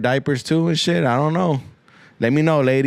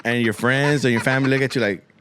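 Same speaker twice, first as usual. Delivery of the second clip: usual speaking voice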